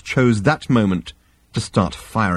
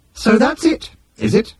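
high-pitched machine character voice; Nutrimatic machine; 13 semitones higher than usual. A narrator's reading. Nutrimatic questioning Arthur's explanation, high machine voice.

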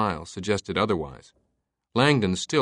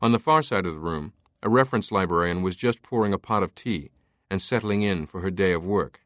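plain reading voice

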